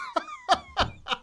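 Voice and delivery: squealing high laugh; shrill laughing fit. Real, not acted. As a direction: lose it completely. Cracks up.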